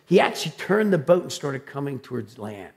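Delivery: usual speaking voice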